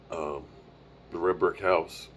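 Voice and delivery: usual speaking voice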